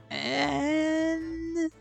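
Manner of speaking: long held word, high and soft; high, quiet, teasingly prolonged; suspenseful drawn-out lead-in. Real, not acted. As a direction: stretch it out, rising, before the reveal. Drawing out reveal of producer.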